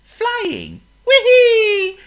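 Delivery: a bird thrilled to fly; delighted squeal; joyful bird. Acted. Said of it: "he cried".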